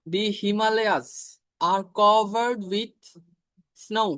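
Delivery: very slow, word by word; teacher reading the example slowly; slow dictation. Shown as a demonstration. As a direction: dictate it for the class. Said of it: "example sentence".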